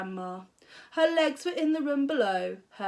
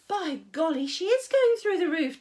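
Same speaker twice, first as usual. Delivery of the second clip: put-on higher alarmed boy; boy's alarm; 6 semitones higher, 4 dB louder than usual. A narrator's reading. George thinking to himself. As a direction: gasp it in alarm.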